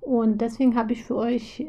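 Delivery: ordinary delivery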